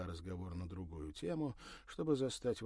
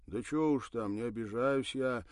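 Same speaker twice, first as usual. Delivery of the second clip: conciliatory; 4 semitones higher, 8 dB louder than usual. Acted. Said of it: "примирительно махнул тот рукой".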